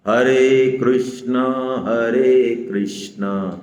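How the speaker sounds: very slow हरे कृष्ण, each syllable drawn out evenly; short-a Krishna demo. Shown as a demonstration. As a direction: spell out हरे कृष्ण slowly with no aa ending. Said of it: "ना पूरा बोलना है".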